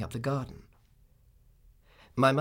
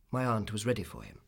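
usual speaking voice

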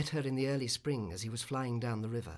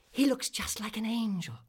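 put-on high, louder children's voice; charity children; 9 semitones higher, 5 dB louder than usual. A narrator's reading. Charity children leaving the cathedral, in a high child's voice. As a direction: pipe up like admiring children. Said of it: "said the charity children".

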